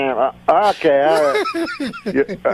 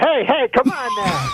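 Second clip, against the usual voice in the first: high-pitched protesting call; mock scolding; 11 semitones higher than usual. Puppeteer interrupts his unruly puppet, high mock scolding. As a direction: cut in to rein the puppet in.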